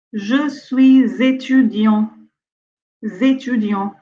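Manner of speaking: Je suis étudiant linked as sui-z-étudiant; liaison demo. Shown as a demonstration. Teacher slowly drilling the liaison for the class. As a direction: stretch the z link into étudiant, slowly. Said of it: "on dit".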